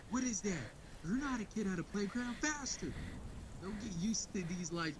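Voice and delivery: high, pushy, barking mom voice, a notch above his own; imitating a relentless trainer mom shouting orders; mock tough-love coach yelling at her kid; drill-sergeant mom. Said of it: "her mom is just hammering her with, uh, the most fucked up words of encouragement".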